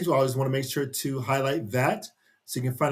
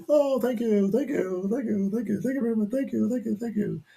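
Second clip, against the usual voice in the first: higher, gushing thank-yous to a crowd; higher gushing thanks from the stage; grateful star; 8 semitones higher than usual. Acted. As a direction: bask in the applause, gushing. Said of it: "and say".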